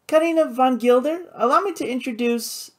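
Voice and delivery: high, grandly announcing feminine voice; playful hostess. A narrator's reading. Flora introducing her brother to Karina, playfully grand. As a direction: introduce someone with playful ceremony, pitched high.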